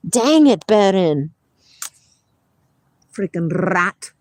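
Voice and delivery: loud fed-up call to the dog; exasperated scolding. Real, not acted.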